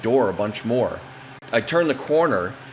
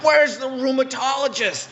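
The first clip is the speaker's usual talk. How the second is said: put-on old lady voice. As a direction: play the confused fallen granny, pitched way up.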